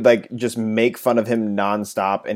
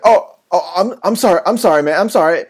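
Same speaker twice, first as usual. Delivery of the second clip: high, louder, panicky sorrys; the freshman, scolded, apologizes in a high nervous rush; flustered apology; 8 semitones higher, 5 dB louder than usual. Retold or imitated. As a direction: stammer apologies like a scared kid.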